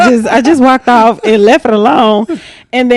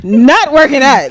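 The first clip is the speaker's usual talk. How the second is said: mock outrage; 8 semitones higher than usual. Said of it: "I'm like".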